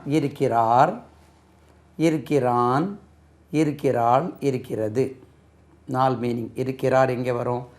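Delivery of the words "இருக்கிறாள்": ordinary delivery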